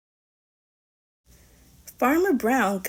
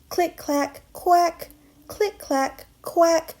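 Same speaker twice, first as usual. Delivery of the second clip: quacking refrain; 7 semitones higher, slower than usual. Acted. Reader voicing the ducks' typing, quacks popping high. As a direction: punch every quack like a duck.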